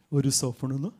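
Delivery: low, menacing challenge; confronting youth. Retold or imitated. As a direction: play a street tough demanding an answer. Youth confronts him, acted.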